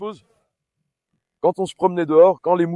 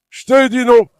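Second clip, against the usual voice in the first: loud, higher stern "no"; stern shout; 7 semitones higher, 9 dB louder than usual. Real, not acted. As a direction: scold the dog hard. Scolding the dog.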